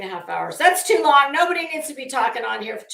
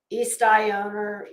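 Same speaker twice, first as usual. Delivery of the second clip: ordinary talk, nothing marked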